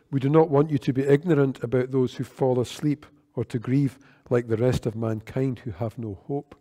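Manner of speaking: ordinary talk, nothing marked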